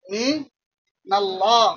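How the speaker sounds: "মিনাল্লাহ" very slow, first syllable held, then a gap; slow dictation. Shown as a demonstration. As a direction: stretch "মিনাল্লাহ" out in two long pieces. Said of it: "তারপরে মিনাল্লাহ লেখা".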